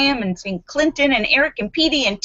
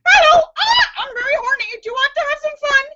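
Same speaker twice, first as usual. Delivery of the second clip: high squeaking parrot voice; very high, fast bird squeak; squeaky parrot; faster than usual. Acted. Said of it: "her parrot squeaks".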